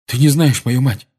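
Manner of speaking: brusque, agitated rebuttal; sharp. A narrator's reading. Seamus answering Dean about his mother, sharp. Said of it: "резко сказал ему Симус".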